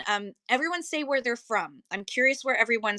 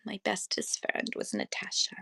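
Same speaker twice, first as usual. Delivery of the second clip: whisper; 10 dB quieter than usual. Real, not acted. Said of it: "unvoiced whisper".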